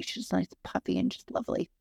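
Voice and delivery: normal talking voice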